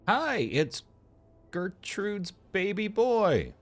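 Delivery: high, slow goofy character voice; put-on patient voice. Voicing the game's patient to the doctor, high and slow. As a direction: read the patient like a silly high-voiced man. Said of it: "I don't know what that was".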